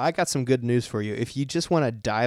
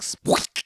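voiceless mock squeak; whispered squeak. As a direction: squeak it under your breath. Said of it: "it's just like".

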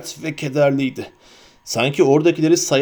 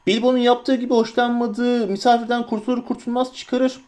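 high-pitched hobbit voice, reasoning; Merry; 8 semitones higher than usual. A narrator's reading. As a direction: argue the point, pitched high. (tiz sesle açıklayan hobbit)

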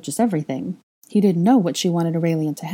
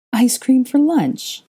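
slow, high disbelieving question; incredulous; 8 semitones higher, 5 dB louder, much slower than usual. A narrator's reading. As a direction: ask it in disbelief. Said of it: "she asked incredulously".

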